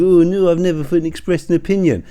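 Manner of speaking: scornful mock voice; mocking whiny protest. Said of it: "get to the end and".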